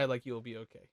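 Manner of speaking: normal talking voice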